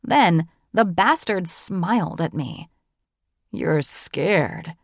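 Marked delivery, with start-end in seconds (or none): 3.5-4.8 lightly lowered smug man's voice; a hint of a cocky wolf; smug John. A narrator's reading. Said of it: "like he was the big bad wolf".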